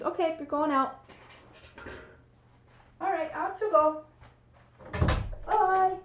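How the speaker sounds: high, sing-song pet voice; talking to the cat. Real, not acted. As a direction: coax the cat out the door, lilting up high. Putting the cat out of the room.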